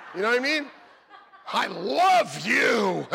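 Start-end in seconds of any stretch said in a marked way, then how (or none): 1.5-3.1 slow, loud mock declaration; bellowed affection. Husband playing an unrestrained lover, bellowed affection. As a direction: belt it out, stretching the middle word.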